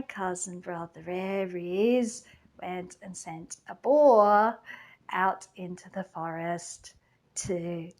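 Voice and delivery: conspiratorial whisper about the boar; hushed whisper. Acted. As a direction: drop to a sinister whisper. Dark reveal of the boar.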